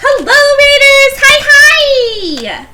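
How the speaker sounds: put-on high, bright valley girl greeting; bubbly valley girl hello. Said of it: "We're doing Valley Girl Apple now".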